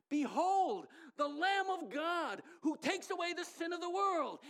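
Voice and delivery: loud, high, slow proclamation; John announcing the Lamb of God to the crowd, shouting; proclaiming shout. Acted. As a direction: cry it out to the whole crowd. Preacher proclaims John's cry.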